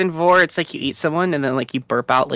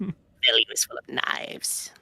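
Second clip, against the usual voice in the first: slow eerie whisper; creepy whisper; 4 semitones lower, 8 dB quieter, slower than usual. Pretend. Spooky line.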